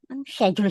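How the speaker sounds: schedule said with a sh start; British schedule. Retold or imitated. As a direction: say schedule as "shed-yool". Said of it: "Sabi ko".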